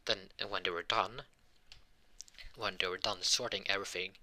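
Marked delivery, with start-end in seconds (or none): none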